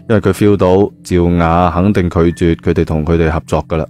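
usual speaking voice

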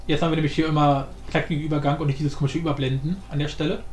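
normal talking voice

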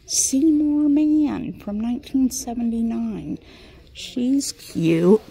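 loud delighted find; excited gasp. Real, not acted. Collector gasping at a vintage figurine, loud and delighted. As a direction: gasp and gush over the find.

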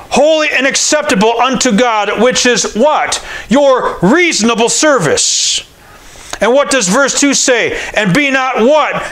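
high, ringing call-and-response preaching; preaching at full force. Acted. Pastor quizzing his congregation on Romans twelve, high fervent preaching. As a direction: drive the verses home to the congregation.

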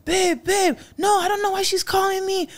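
high-pitched imitation of a pleading husband; whiny mock excuse-making to a wife; pleading denial. Imitates boyfriend pleading with his wife.

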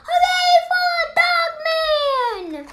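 high, slow, drawn-out hooray; triumphant cheer. Acted. Kid narrator cheering the hero's birth, high and slow. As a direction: stretch it out like a victory chant.

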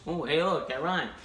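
ordinary talk

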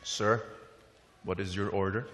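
soft, low waiter taking an order; quietly deferential server voice; polite waiter. Acted. Waiter with a notepad addresses the seated man, polite low voice. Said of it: "The waiter comes with a little notepad".

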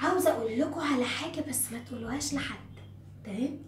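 hushed, low, quick aside; confiding a secret. Pretend. Teacher telling pupils not to tell anyone, hushed secret. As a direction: lean in and share a secret very softly.

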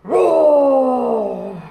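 loud, drawn-out mock dinosaur roar. Showing children how to roar at song's end, playful dino roar.

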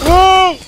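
sharp high yelp of surprise; startled shout. Real, not acted. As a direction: cry out, caught off guard. Sudden hit in battle.

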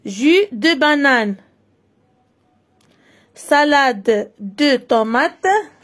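"Salade de tomates" read slowly and deliberately; teacher reading the menu aloud for pupils. Shown as a demonstration. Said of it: "اقرأ القائمة".

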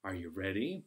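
ordinary talk, nothing marked

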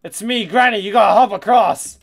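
loud hammed-up granny voice; mock Granny voice. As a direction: yell it like an excited old granny. Reading Granny's sign in a voice.

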